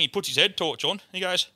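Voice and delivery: ordinary delivery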